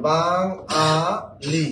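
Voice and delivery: "বাঙালি" broken slowly into বাঙ, আ, লি; syllable by syllable. Shown as a demonstration. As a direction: draw out "বাঙালি" with a separate, long আ. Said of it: "আ আসতেছে উচ্চারণ".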